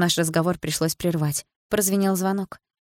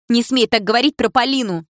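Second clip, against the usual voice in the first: angry shout; 5 semitones higher, 6 dB louder than usual. A narrator's reading. Dilara attacking Milana, shouting.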